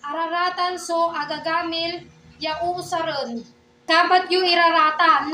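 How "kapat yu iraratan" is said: calling out loudly. Real, not acted. Teacher calls out to class.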